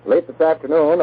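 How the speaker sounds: normal talking voice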